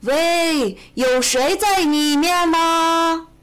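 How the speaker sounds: long drawn-out loud, high call. A narrator's reading. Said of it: "朝下面喊道". (拖长声音朝下喊)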